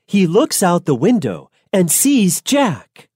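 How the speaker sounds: ordinary delivery, nothing marked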